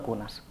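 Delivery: "cunas" said with a hard initial K; "cunas" starting on a K sound, as in Panama. Shown as a demonstration. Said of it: "seguimos pronunciando una K o una C".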